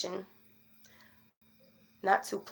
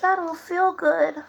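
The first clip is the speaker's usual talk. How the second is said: high, slow, whiny little-dog voice; high, drawn-out pouty dog voice; voicing the sick dog; 8 semitones higher, 6 dB louder, slower than usual. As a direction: speak for the dog, pitiful and slow.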